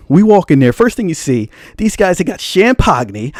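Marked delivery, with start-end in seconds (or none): 2.4-3.3 "champagne" said as sham-POG-nee; mock mispronunciation. Shown as a demonstration. Bragging about the private area, "champagne" comically said sham-pog-nee. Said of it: "I can't even pronounce it".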